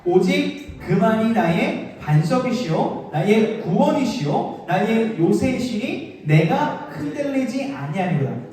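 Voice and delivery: slow, measured group reading of the psalm; solemn unison Bible recital; scripture reading. Shown as a demonstration. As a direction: read it out slowly together, like a congregation. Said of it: "육 절 읽어볼게요".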